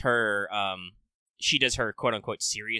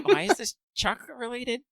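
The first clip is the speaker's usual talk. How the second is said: high, incredulous question; baffled disbelief; 12 semitones higher than usual. Real, not acted. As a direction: ask it like this makes no sense at all. Baffled by the cursed book event.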